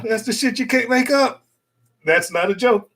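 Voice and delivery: ordinary talk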